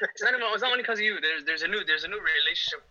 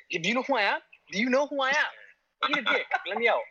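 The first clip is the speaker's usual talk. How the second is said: high-pitched, indignant demand; mock screaming baby; 9 semitones higher than usual. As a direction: act a cocky newborn demanding release, pitched way up. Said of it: "This guy's screaming".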